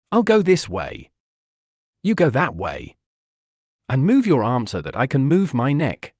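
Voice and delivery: understated frantic yelling back; mild yell. A narrator's reading. Courage yelling back at Noelle while tangled, mildly frantic. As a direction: shout directions back, half-hearted. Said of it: "Courage yelled at Noelle".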